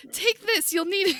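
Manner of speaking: put-on high-pitched old man handing over a gift; old cave man. Co-host mimicking the old man in the cave for fun, old cave man voice. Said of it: "like the old man in the cave".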